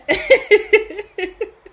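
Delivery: high, loud laugh rising up; giddy happy laugh; overjoyed laugh. Real, not acted. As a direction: laugh out in tearful delight. Overwhelmed by the reading.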